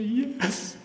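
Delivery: high, tearful yes through weeping; imitating a wife breaking into tears; tearful high-pitched yes, yes, we are; crying yes. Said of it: "she just began to cry and weep".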